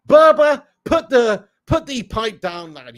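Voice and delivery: loud, high call at a viewer; loud teasing call at a chat commenter. Real, not acted. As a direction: bellow his name across the room, then slow down on the pipe.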